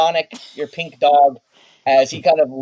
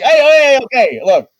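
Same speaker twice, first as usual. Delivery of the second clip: much higher, loud panicked protest; Tommy scrambling away from the dog; alarmed backpedal; 10 semitones higher, 9 dB louder than usual. Acted. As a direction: throw up your hands and back off fast.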